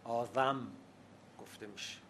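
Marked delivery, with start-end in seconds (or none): 0.0-0.7 آدم with interdental th in place of d; Bakhtiari zal. Shown as a demonstration. Linguist shows Bakhtiari speech, آدم said with a th. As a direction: say آدم as "aa-dham", th as in "this".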